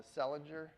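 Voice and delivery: Selinger said as SELL-in-jer; mispronounced name. Real, not acted. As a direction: say Selinger with a j sound in the middle. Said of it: "I always said her name wrong".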